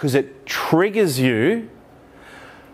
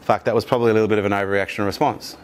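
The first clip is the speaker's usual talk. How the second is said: lower, fast sheepish admission; 7 semitones lower, faster than usual. Retold or imitated. After sobering up, admitting it quickly in a lower, sheepish voice. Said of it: "you calm down, sobered up".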